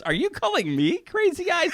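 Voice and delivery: high-pitched imitation of indignant disbelief; mock incredulous. Housemate challenging Angela's insult, mock incredulous. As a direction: question it in a high, affronted voice. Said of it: "he goes".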